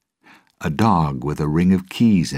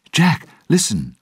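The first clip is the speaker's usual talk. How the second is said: urgent appeal; 11 semitones higher, 4 dB louder than usual. Acted. Will urgently appeals to Jack.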